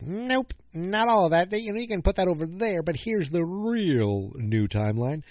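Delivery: dismissive mock voice. Mocking a fan theory.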